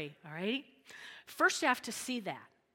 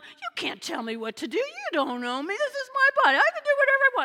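rising into a high loud protest; defiant voice; 12 semitones higher, 8 dB louder, faster than usual. Retold or imitated. Pastor mocking culture's defiant claim, rising loud. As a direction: climb to a shrill-free high defiant shout. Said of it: "men and women are going".

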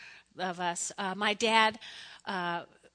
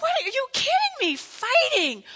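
much higher, louder disbelieving outburst; exasperated parent; 13 semitones higher, 5 dB louder than usual. Retold or imitated. Mother scolding kids fighting over cereal, exasperated. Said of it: "I was like".

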